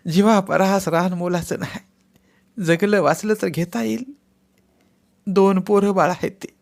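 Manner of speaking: wife weeping as she begs her husband; sobbing plea. Acted. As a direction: plead with him to sign through sobs. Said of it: "मुसमुसतच म्हणाल्या".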